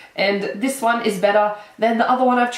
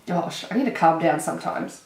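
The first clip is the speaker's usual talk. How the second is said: ordinary delivery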